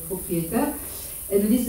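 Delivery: usual speaking voice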